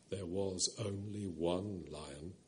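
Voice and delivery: a low, slow, gentle lion's voice. A narrator's reading. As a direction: rumble softly like a kind great lion. The Voice of Aslan.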